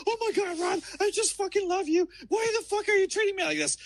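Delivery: very high-pitched mock pleading; imitated distraught girlfriend. Ex pleading with him during the drive, high-pitched mock distress. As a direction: wail it high, mimicking an upset girlfriend. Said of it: "She's like".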